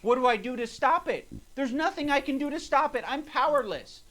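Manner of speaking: high, whiny mock self-pity; mock whiny victim. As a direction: whine it like a helpless victim. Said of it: "you're like".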